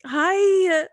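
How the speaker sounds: high, loud mock-weepy thanks; hammed-up emotional greeting; tearful gratitude. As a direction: gush to a fan as if choking up. Greeting reviewer after getting emotional.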